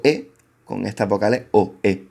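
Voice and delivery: ordinary talk, nothing marked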